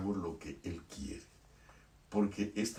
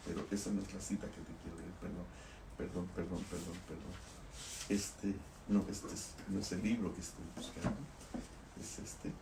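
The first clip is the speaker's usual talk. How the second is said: muttered aside; 7 dB quieter, faster than usual. Real, not acted. Muttered aside while searching book.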